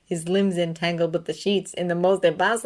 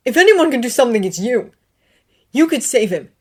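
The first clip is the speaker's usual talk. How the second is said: higher, louder, pleading; tearful begging; 6 semitones higher, 10 dB louder than usual. A narrator's reading. Severus begging Dumbledore in tears. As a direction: beg him to save the boy. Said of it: "tears ran down his face".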